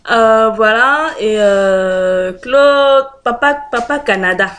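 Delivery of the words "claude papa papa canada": calling out. Real, not acted. Calling out to the vlogger.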